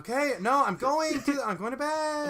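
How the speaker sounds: loud, high bratty protest; whining kid. Pretend. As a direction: holler back like a stalling child. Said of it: "like, the kid who's like".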